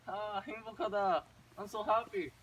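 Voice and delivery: mock joyful wail; overjoyed. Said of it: "they're just very happy".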